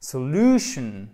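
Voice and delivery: "Solution" drawn out, LU punched, last syllable falling; stress on second syllable. Shown as a demonstration. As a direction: stretch and stress the middle of "Solution". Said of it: "donc sur l'avant-dernière syllabe". (accent tonique marqué sur l'avant-dernière syllabe de « Solution »)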